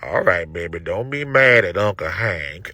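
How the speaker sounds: put-on calm the girl down like a laid-back uncle; Lucky's soothing voice. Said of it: "He be like".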